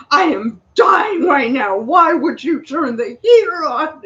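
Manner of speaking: hammed-up crying complaint, slow and loud; mock dramatic wail. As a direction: wail it like you're dying of heat. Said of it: "I'm like".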